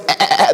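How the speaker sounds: imitated sheep bleat; short stuttering baas. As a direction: mimic sheep in the background. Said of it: "the sheep is in the background".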